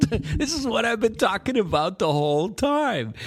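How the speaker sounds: talking through laughter; laughing. Real, not acted. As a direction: laugh while saying it.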